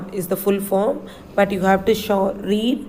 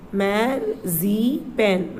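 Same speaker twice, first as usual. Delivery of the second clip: very slow, stretched marzipan; drawn-out model word; much slower than usual. Shown as a demonstration. Teacher drawing out Marzipan for children to copy. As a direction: stretch every syllable of Marzipan out long.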